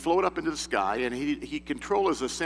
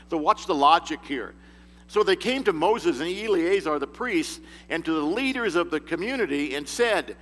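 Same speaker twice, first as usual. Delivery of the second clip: usual speaking voice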